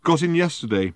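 plain reading voice

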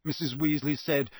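ordinary delivery

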